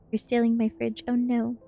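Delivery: ordinary delivery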